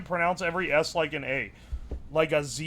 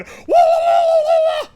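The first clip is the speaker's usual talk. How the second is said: very high, loud sustained "wah-wah" yell; loud wailing wah; 11 dB louder than usual. Retold or imitated. Streamer acting out the open-mouthed emote, wailing very high and loud. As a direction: yell out a high, wobbling cartoon wail. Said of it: "and he's going".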